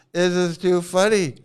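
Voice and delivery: ordinary talk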